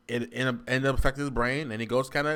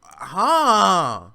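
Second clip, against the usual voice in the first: loud, high, incredulous huh; baffled outburst; 7 semitones higher, 11 dB louder than usual. Real, not acted. Reactor baffled that a great game is bad, baffled outburst. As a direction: blurt it loudly in disbelief.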